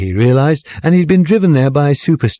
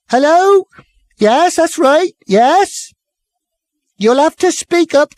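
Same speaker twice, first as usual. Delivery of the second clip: shouting barmaid on the phone. A narrator's reading. Barmaid shouting into the phone over the noisy bar. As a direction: yell down a bad phone line over the racket.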